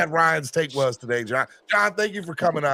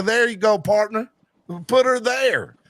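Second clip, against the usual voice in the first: a playful put-on Southern accent, a bit higher; hammed-up Southern cowboy greeting, raised a little; mock Southern drawl; 6 semitones higher, 3 dB louder than usual. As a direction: greet him like a good ol' Southern partner in a Southern accent. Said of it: "I'm just gonna talk to you like you a good Southern folk".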